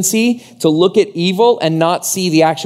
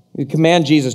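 ordinary delivery

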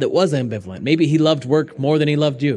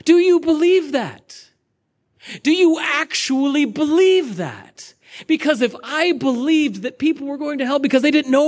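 incredulous atheist; 11 semitones higher than usual. Retold or imitated. Quoting an atheist told about Jesus, high pleading disbelief. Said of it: "and he says".

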